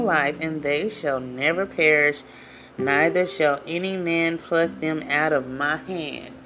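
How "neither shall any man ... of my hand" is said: ordinary delivery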